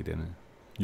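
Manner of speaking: usual speaking voice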